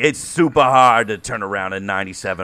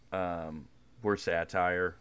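ordinary talk, nothing marked

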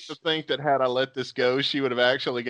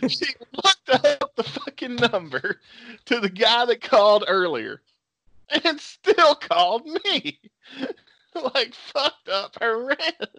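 high, laughing incredulity; amused disbelief; 9 semitones higher than usual. Real, not acted. He tells how she still called him, laughing in disbelief. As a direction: crack up at the absurdity.

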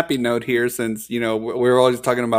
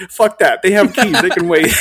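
laughing retort; 6 semitones higher, 6 dB louder than usual. Retold or imitated. Friend's defiant reply retold.

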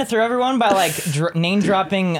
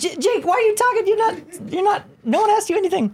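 mock-scolding, high; high teasing; 10 semitones higher than usual. Real, not acted. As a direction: tease him, pitch up. Teasing outburst at Jake.

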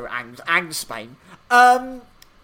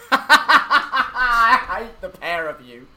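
loud, slow, real laughter through the words; higher-pitched, drawn out through laughter; laughing; 6 semitones higher, 9 dB louder, much slower than usual. Real, not acted. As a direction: laugh helplessly while protesting. Teased, laughing along with co-hosts.

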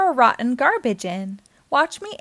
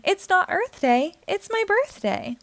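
higher, proud, singsong claim; a silly monkey proudly insisting; 7 semitones higher than usual. A narrator's reading. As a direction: insist on your birthday with silly pride. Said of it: "proudly said".

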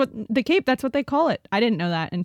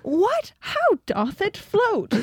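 astonished; 10 semitones higher, slower than usual. Real, not acted. Amazed at the fish.

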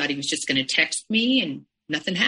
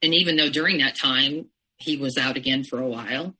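usual speaking voice

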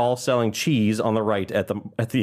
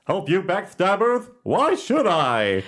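slow, higher, sing-song goose rhyme; Choose Goose voice; 7 semitones higher, slower than usual. Acted. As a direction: recite the rhyme like a goofy goose seller. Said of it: "Which is Choose Goose".

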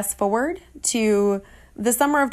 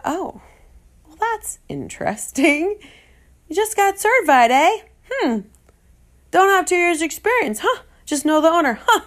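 sarcastic inner voice; 9 semitones higher, 4 dB louder than usual. Retold or imitated. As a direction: needle him with fake sweetness. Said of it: "what's going on in my head".

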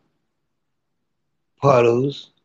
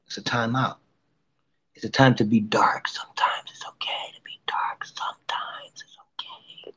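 soft whisper; 3 semitones lower, 8 dB quieter than usual. Real, not acted. Whispered reassurance about darkness.

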